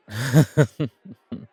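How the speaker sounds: a short burst of laughter, tapering off; amused chuckle. Acted. Amused reply to bumped-into stranger.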